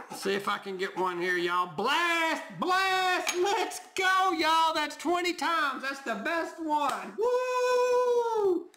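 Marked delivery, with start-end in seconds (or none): 1.8-7.1 loud, high whoops of real joy at a winning ticket; excited shout. Real, not acted. Scratching a lottery ticket for viewers, shouting with real joy. Hits a winning blast symbol. 7.2-8.7 high, loud sung woo of real joy; whoop. Real, not acted. Cheering his own lottery win for viewers, a long loud whoop.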